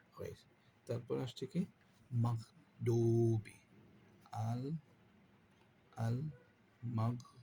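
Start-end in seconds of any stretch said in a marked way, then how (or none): 2.1-7.4 slow Quranic chant; drawn-out recitation. Shown as a demonstration. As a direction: stretch the word as in recitation. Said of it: "teacher demonstrates recitation". (ধীর তিলাওয়াতের সুর)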